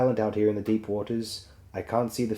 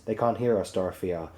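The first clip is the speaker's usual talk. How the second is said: usual speaking voice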